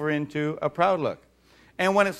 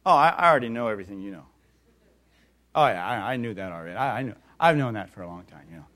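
low, fast, smug brush-off; know-it-all mock; 7 semitones lower, faster than usual. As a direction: rattle it off like you've heard it all. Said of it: "let me interpret that for you".

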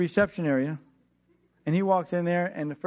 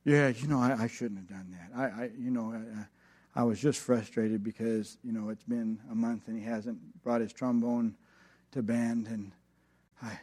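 softer, lower mumbled excuse; sheepish; 7 semitones lower, 5 dB quieter than usual. Retold or imitated. Teacher caught out by the angry father, sheepish and softer. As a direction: back down and make excuses. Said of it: "he gets all sheepish".

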